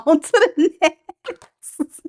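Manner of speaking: high, slow words through laughter; laughing. Real, not acted. Host moving on to the next sign, laughing. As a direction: draw it out while cracking up. Cracks up at her own line.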